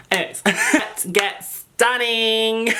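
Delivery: giggling through it, drawn out slowly; laughing. Real, not acted. Wrapping up her self-plug to viewers, laughing. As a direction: laugh as you kick off the look.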